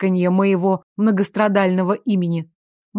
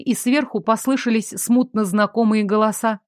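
plain reading voice

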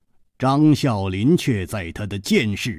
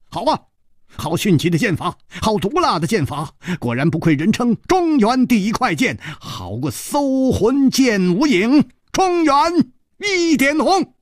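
high, exclaiming praise with a grin; smiling admiration; 13 semitones higher, 5 dB louder than usual. A narrator's reading. Hero praises the killer's swordplay, high smiling admiration. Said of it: "张啸林微笑着说".

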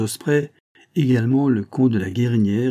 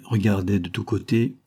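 plain reading voice

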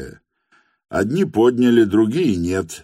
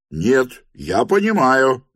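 higher, moderately raised voice; contradicting cry; 7 semitones higher, 4 dB louder, slower than usual. A narrator's reading. Third officer contradicts the others, higher raised voice. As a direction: cut in with a keen cry. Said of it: "крикнул третий".

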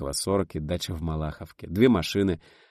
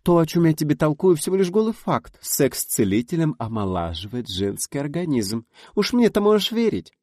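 higher, louder insistent voice; emphatic friend; 8 semitones higher, 5 dB louder than usual. A narrator's reading. Friend insisting to Rita it is a plain fact. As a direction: drive the point home.

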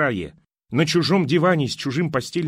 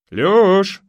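loud call over the fence, pitched up; shouted; 7 semitones higher, 9 dB louder than usual. Acted. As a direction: call out the name from next door. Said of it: "закричали из-за забора".

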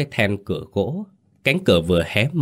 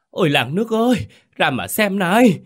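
panicked scream; 12 semitones higher, 3 dB louder than usual. A narrator's reading. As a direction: cry out to the whole village in terror. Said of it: "một tiếng hét thất thanh vọng về". (tiếng hô thất thanh, cao vút)

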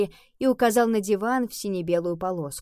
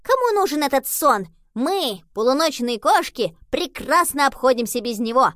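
put-on loud, high-pitched defiant young cat; defiant kitten; 6 semitones higher, 6 dB louder than usual. A narrator's reading. Pablo brushes off Horatio's warning about sleep, loud and defiant. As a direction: crow it boldly, high and loud.

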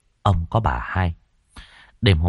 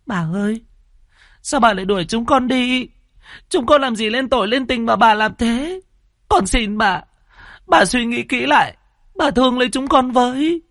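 put-on young servant girl pleading, much higher and whimpering. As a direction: beg the mistress to keep her, close to tears. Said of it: "mếu máo".

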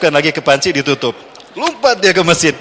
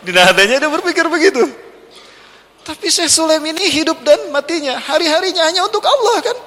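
laughing; 12 semitones higher than usual. Real, not acted. Laughing while narrating.

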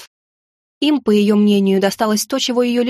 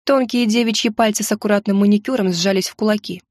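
ordinary delivery

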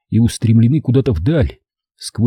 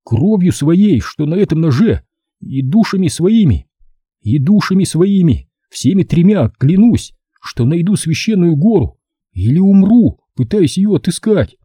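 higher-pitched, emotional solemn vow; trembling oath; 7 semitones higher than usual. A narrator's reading. Boy swears on his father's knife, trembling and solemn. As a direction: swear it while holding back distress. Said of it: "дрожащим голосом произнёс клятву".

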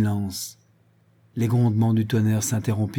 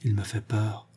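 a soft frightened murmur; 5 dB quieter than usual. A narrator's reading. Otto murmurs his fear to Conrad. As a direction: say it under your breath, uneasy. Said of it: "Otto murmura".

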